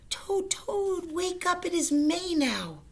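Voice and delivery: very high, loud wake-up call; excited high call. Acted. Frog wakes Toad, excited high loud call. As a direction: burst in calling him awake. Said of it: "ran back to Toad's bed".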